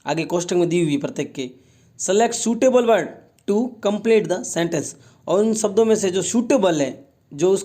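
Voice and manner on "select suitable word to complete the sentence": slow instruction reading. Shown as a demonstration. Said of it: "teacher reads instruction slowly".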